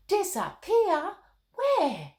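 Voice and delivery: put-on high, loud, eager little girl asking; a child leaning in, bursting to know; a little girl's high, excited questions. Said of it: "Molly could feel her heart start to race".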